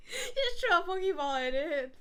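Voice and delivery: high, squeaky laughing delivery; helpless laughter. Real, not acted. Picturing Nanfeng throwing Pokeballs at brides, laughing helplessly. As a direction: push the words out through giggles.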